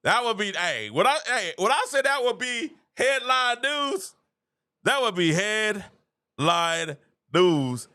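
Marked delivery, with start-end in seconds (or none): 1.6-7.9 drawn-out hype. Pretend. Hyping the joke signing.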